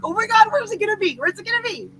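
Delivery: high, fast, eager "where's it gonna be"; excited squeal. Retold or imitated. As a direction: gush like a kid hunting for a present. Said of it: "that thing that you're so excited about".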